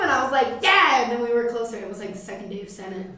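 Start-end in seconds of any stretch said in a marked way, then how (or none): 0.6-1.0 excited. Retold or imitated. Own excited reply quoted.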